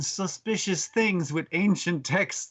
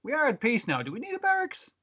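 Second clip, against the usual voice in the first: rising far higher on barracks; mock question; 9 semitones higher than usual. Townsfolk doubting the barracks, high mock question. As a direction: ask it with a big high lilt.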